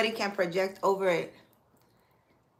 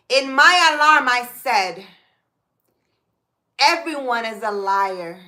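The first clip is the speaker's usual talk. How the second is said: psalm reading; 2 semitones higher, 4 dB louder than usual. Acted. Reads psalm aloud.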